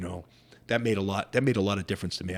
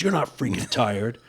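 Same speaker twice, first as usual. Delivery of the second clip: higher, louder mock coach rebuke; imitating insistent coach; 4 semitones higher, 6 dB louder than usual. Coach to gassed fighter mid-tournament, insistent. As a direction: imitate a cornerman firing up his fighter. Acted corner coach urging him on.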